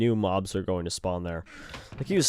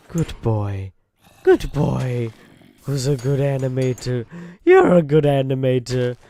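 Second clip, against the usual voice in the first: mock-sweet cooing that grows louder; creepy petting voice; 3 semitones higher, 4 dB louder than usual. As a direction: coo at him like a pet owner, building up. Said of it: "that would be creepy".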